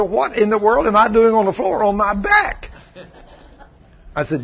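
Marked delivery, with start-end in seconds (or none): 0.0-2.7 high, incredulous retold question; bewildered protest. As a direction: imitate a baffled, put-out woman. Acting the bewildered woman's protest.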